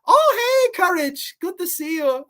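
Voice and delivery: high, cheerful granny voice; sweet old lady. Retold or imitated. Voicing Muriel greeting the dog in a high granny voice. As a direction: greet him like a kind old farm woman.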